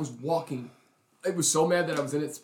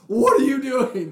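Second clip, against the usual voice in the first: slow loud high shout; shocked yell; 10 semitones higher, 9 dB louder, slower than usual. Retold or imitated. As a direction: yell it at the dog. Yelling at dog attacking girl.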